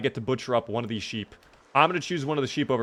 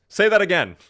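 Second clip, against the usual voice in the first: loud, raised-pitch demand; Fantastic Four gif quote; 6 semitones higher, 7 dB louder than usual. Acted. Streamer acts out Fantastic Four gif, loud demand. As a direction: throw it out loud and high like the gif. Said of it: "The little, little Fantastic Four gif".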